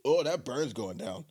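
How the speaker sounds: strained gasp as it burns down; burning throat. Real, not acted. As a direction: wince through the burn. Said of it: "Swallowed it too fast".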